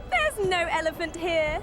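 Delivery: put-on drunken laugh.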